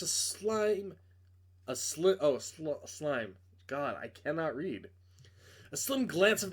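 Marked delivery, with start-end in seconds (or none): none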